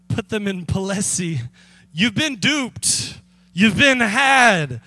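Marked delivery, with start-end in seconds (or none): 1.9-4.8 slow, taunting reveal, the last line louder; mock gotcha. Pastor playing the prankster, slow and taunting. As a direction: draw out the gotcha slowly to the duped buyers.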